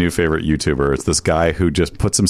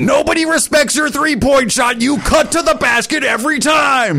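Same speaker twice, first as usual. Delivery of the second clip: yelling YouTuber; slower than usual. Retold or imitated. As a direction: rant at the player at full volume. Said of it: "he just screams the whole time".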